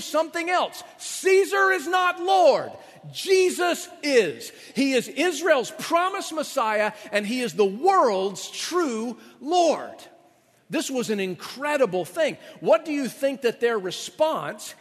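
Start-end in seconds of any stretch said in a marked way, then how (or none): 1.0-9.9 high, loud, slow proclamation; fiery pulpit declaration; preaching at full force. Acted. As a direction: thunder it out to the congregation.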